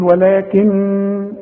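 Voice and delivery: ولكن with the doubled ن nasalized and held; ghunna on noon. Shown as a demonstration. Teacher models the ghunna on ولكن. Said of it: "لازم تغن كل نون".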